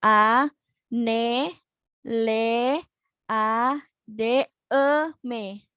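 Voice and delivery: anladem broken into letters and syllables for dictation; dictating spelling to students; spelled out. Shown as a demonstration. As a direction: spell "A, ne, le, a, d, e, me" piece by piece. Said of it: "coba teman-teman tulis A".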